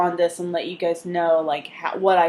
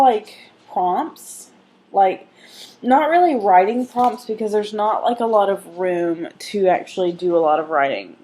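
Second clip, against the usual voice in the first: normal talking voice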